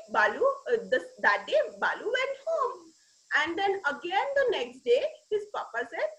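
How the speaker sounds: plain reading voice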